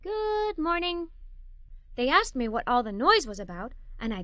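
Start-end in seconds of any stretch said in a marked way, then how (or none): 0.0-1.1 slow, sheepish good morning. Acted. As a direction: drag it out slowly, caught in the act. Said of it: "slowly said".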